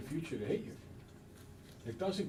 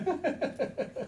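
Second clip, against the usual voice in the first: mock laugh; 10 semitones higher than usual. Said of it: "You're like".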